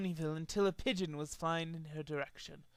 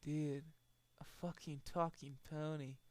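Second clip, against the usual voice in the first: very soft, dazed murmur; stoned mumble; 3 semitones lower, 15 dB quieter than usual. Acted. Stoned Pinkie Pie marvelling at Trixie, soft dazed murmur.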